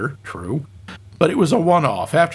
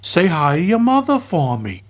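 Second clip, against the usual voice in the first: higher, lilting celebrity impression; comic impersonation, pitched up at the start; 4 semitones higher than usual. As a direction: do a playful tough-guy actor impression. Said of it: "That is my flawless [name] impression".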